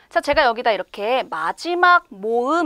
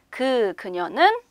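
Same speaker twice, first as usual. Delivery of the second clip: normal talking voice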